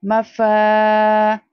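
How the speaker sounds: मफा very slow, फा drawn long; stretched faa. Shown as a demonstration. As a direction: hold the फा. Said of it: "Again अलिफ मद्दा". (मफा में फा खींच कर)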